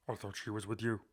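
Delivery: low, soft distorted Batman voice; a hint of voice distortion; Affleck voice distortion. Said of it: "a warbler. A kind of a voice distortion thing".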